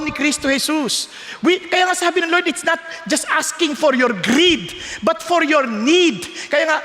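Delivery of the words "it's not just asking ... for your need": each word of "greed" and "need" laid down slowly; slow emphasis. Shown as a demonstration. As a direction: drag it out so "need" lands hard. Preacher contrasts greed and need.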